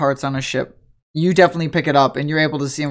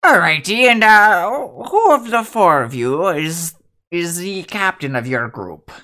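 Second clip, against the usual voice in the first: lightly voiced cheery sea dog; hearty sailor greeting a visitor; jolly old shipwright; slower than usual.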